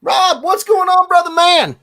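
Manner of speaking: high, loud, excited hello; big cheery shout-out; hyped greeting. Real, not acted. Greeting a chat viewer.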